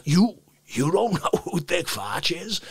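normal talking voice

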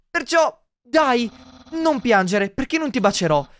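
theatrical friendly reassurance; Papyrus voice. Acted. Papyrus consoling the human as a friend, theatrical.

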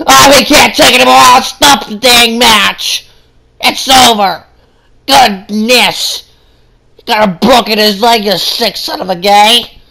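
mock-angry ringside fan; upset outburst. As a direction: rant at the ref like a furious fan. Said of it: "Sorry, I got a little upset".

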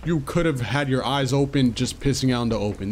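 ordinary talk, nothing marked

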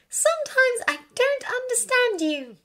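ordinary delivery

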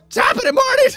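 very high, squeaky catchphrase through laughter; laughing falsetto; mock YouTuber greeting. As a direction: squeal it while cracking up. Mimics YouTuber catchphrase while laughing.

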